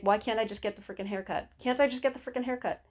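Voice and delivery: fast, muttered-thought exasperation; mildly frustrated, quick-fire complaint; stressed owner. Retold or imitated. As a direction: imitate a harried customer rushing the words. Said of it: "they're just trying to think".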